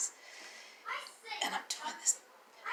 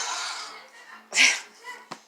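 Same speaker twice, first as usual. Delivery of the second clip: high squealing laugh burst; high giggle; 4 dB louder than usual. Real, not acted. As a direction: let a high giggle escape. Giggling about green Osiris.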